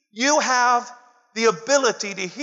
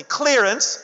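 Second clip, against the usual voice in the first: "Clearance" sung out higher, leading the crowd; call and response; 4 semitones higher than usual. Shown as a demonstration. Preacher leads congregation to repeat, calling "Clearance". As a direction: lift "Clearance" for them to echo. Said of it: "Come on, say with me".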